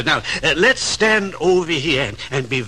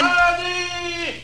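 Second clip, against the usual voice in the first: calling high up the stairs, raised pitch; 13 semitones higher than usual. Acted. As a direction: call for your wife from another room. Husband calls wife again.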